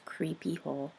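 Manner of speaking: plain reading voice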